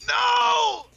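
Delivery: sudden high yelled no; shout. Real, not acted. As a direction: yell out in real dismay. Loses the Pokemon.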